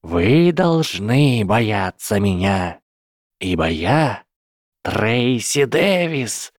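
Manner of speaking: slow, theatrical, menacing proclamation; ominous dark-lady voice. Acted. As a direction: declare yourself a dark lady. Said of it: "низким голосом зловеще". (зловещий голос тёмной леди)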